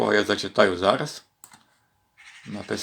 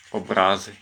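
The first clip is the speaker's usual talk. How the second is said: образи stressed on the middle syllable, РА; образи as обрáзи, stress in the middle. Shown as a demonstration. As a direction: say об-РÁ-зи, weight on the second vowel. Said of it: "як поставиш наголос".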